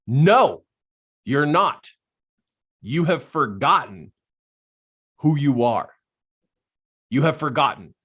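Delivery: firm, emphatic angel on the shoulder; angel's voice. Retold or imitated. Angel rebukes the man on his drive home, emphatic. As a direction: imitate an inner voice in short, separated phrases, starting loud. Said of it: "the voice on the other shoulder".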